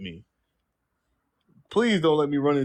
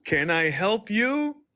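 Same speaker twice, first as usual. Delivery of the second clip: slow, high bank-teller greeting; 8 semitones higher, much slower than usual. Retold or imitated. Bank teller greeting a customer, slow and high. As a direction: drawl it out like a polite teller. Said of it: "when you walk up to the teller".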